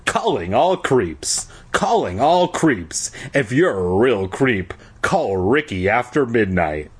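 usual speaking voice

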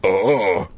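short low moan; low cry.

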